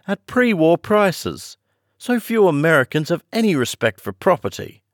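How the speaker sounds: high, thrilled exclamation; girl marvels at the destruction; excited cry. A narrator's reading. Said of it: "cried Kisman".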